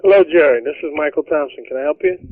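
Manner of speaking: normal talking voice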